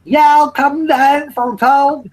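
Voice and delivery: loud, high, drawn-out hillbilly holler; toothless hillbilly. Acted. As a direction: ham up a toothless hillbilly, stretching every word. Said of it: "the hillbilly types with no teeth".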